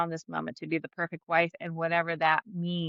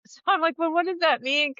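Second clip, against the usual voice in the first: laughing; 9 semitones higher than usual. Real, not acted. Laughing at husband's wish.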